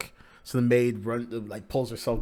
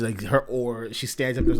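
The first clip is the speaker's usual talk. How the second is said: ordinary delivery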